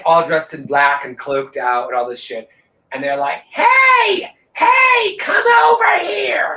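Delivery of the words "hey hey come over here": loud, very high call across a campground; imitating strangers yelling someone over; abrasive holler. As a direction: holler it out, stretched and piercingly high. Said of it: "prepared for that kind of abrasiveness".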